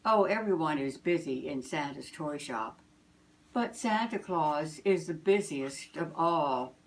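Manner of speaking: ordinary delivery, nothing marked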